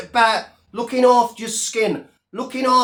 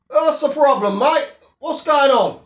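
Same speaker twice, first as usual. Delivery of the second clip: usual speaking voice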